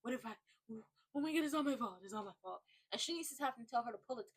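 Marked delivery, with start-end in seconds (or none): 0.0-2.6 a touch of fake sobbing panic; imitating Vanilla Bean crying over Derek; mock crying. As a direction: lightly play her falling apart in tears. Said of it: "Vanilla Bean is crying like".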